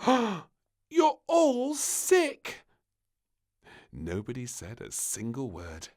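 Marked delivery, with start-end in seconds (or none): 0.1-2.7 very slow, high, loud shocked cry; the princess is shocked that everyone is sick. Acted. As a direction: drag it out in disbelief.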